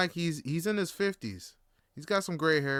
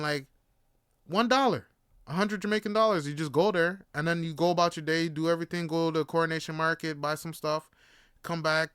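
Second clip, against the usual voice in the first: ordinary talk, nothing marked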